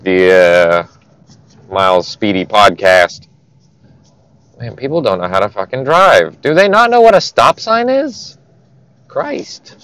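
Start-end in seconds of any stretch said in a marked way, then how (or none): 4.6-9.8 irritated griping at other drivers, rising high on the question; real road rage. Real, not acted. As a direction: grumble in disbelief at bad driving. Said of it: "some actual road rage here".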